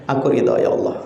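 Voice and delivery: whispered surrender to God. Retold or imitated. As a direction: breathe the words, voiceless. Prayer repeated, whispered. (berbisik pasrah)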